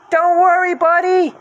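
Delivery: loud, high reassurance; Shaggy reassuring Scooby above; called up. Acted. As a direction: call up to the wheel. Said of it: "Shaggy called".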